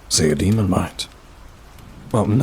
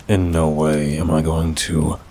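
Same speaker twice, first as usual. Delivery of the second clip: ordinary talk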